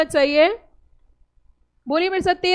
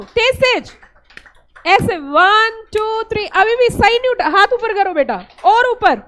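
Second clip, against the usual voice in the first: energetic rallying; 3 semitones higher, 4 dB louder than usual. Real, not acted. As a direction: fire up a sleepy class. Teacher rallying class to clap.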